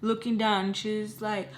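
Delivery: plain reading voice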